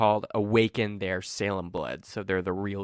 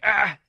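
sharp higher cry of pain; pained gasp; 11 semitones higher, 8 dB louder than usual. Acted. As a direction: yelp as knives bite. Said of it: "And he's like".

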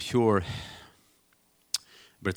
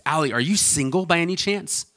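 imitating a prying mom, higher pitched; overbearing mom; 7 semitones higher, 4 dB louder than usual. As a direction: lightly play a matchmaking mother. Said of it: "with an overbearing stranger".

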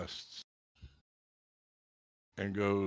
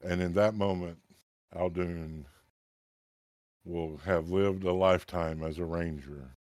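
solemn narration; 3 semitones lower than usual. A narrator's reading. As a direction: intone it like a legend being told. GM narrates the transformation.

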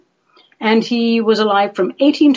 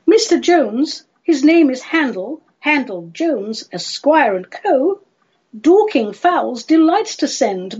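theatrical verse refusal in a raised voice; Lady Jingly refusing; 2 semitones higher than usual. Acted.

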